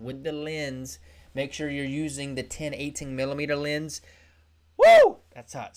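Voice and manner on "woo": sudden high whoop of delight; thrilled whoop, very loud and high; excited yell. Real, not acted. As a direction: let out a loud whoop about the camera. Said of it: "did I yell in your ear?".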